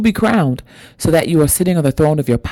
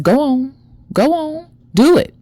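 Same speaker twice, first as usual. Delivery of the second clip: high-pitched voice egging someone on; imitated spirit woman's urging; urging ancestor; 11 semitones higher than usual. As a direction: coax them insistently, pitched way up.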